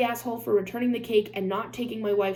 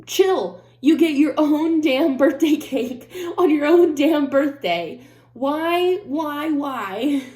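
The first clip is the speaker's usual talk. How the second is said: loud, high-pitched scolding; exasperated rant; 5 semitones higher, 6 dB louder, slower than usual. Real, not acted. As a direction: let the indignation burst out, hammering the words.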